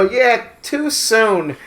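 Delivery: drawn-out deadpan quip; retelling his own joke, slow and dry. Retold or imitated. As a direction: stretch it out, dry. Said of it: "I go".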